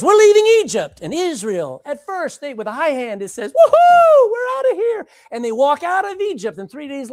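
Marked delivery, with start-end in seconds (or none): 0.0-1.0 higher, louder announcement; a triumphant leader's call; proud proclamation. Acted. Moses announcing the exodus. 3.5-5.1 high, loud whooping cheer; a crowd's wild woohoo. Acted. Israel celebrating leaving Egypt.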